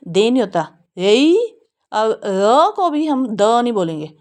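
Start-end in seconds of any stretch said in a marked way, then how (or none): none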